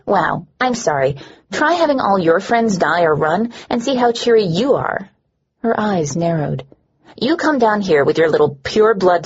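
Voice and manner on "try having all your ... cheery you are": ordinary delivery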